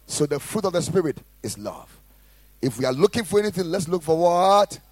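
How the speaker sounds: loud, high, fervent sermon delivery; full-force preaching. Acted. Pastor exhorting the congregation, loud fervent preaching. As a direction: preach it hard to the room.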